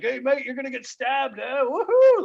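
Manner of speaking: high, loud mocking cheer ending in a whoop; crowd taunt. Crowd jeers at the comedian about getting stabbed, whooping. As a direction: jeer like a rowdy pub crowd. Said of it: "everybody again went like".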